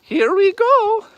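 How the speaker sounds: very high, drawn-out mock Italian accent. Shown as a demonstration. Streamer showing off to viewers, squeaky hammed-up Italian accent. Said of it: "Here's my Italian accent".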